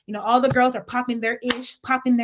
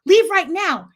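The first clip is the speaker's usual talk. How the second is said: higher and louder, mock angry; a girl angrily ordering her out, mock yelling; 6 semitones higher, 5 dB louder than usual. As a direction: throw her out with mock fury. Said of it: "she was like".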